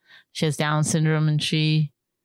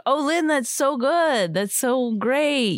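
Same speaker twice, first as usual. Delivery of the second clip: high, slow, gushing praise; overly encouraging praise; 6 semitones higher, slower than usual. Retold or imitated. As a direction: lay on the praise like for a child. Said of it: "were all like".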